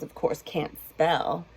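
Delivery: plain reading voice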